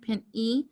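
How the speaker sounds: pen E, with the final long E set apart; long E ending. Shown as a demonstration. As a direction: break it as pen, then a clear E. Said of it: "'cause you hear that E at the end".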